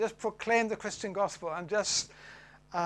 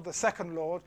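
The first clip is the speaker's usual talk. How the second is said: ordinary delivery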